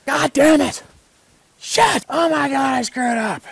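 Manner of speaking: mock yelling curses, way up high and loud; imitating furious outburst. Hunter beating himself up by the fire, imitated furious yell. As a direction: ham up the hunter losing it at himself. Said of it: "all of a sudden he'd be like".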